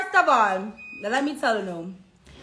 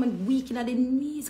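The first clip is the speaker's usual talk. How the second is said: ordinary delivery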